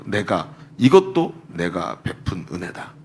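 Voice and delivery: low, slow, weighty divine voice; voice of God. Retold or imitated. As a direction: lay each word down slowly and low, as God. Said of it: "딱 정확한 음성으로". (낮고 느리게 또박또박 하나님의 음성)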